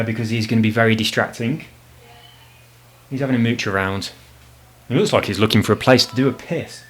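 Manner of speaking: ordinary delivery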